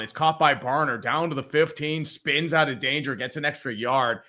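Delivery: sportscaster calling the play. Acted. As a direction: call it like a TV announcer. Live game play-by-play.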